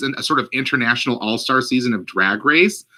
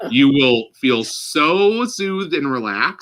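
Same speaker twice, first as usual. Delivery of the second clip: soothing voice; much slower than usual. Pretend. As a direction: lull the listener to sleep. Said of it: "parodying soothing narration".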